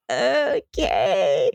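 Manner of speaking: long, drawn-out falling okay; OKAY stretched into a mild whine; reluctant compliance. Acted. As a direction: drag out OKAY as you give in. River answers being called over.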